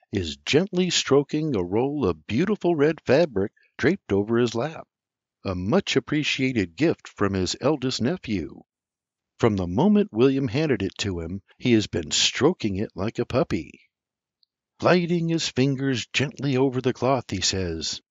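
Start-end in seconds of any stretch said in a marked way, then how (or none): none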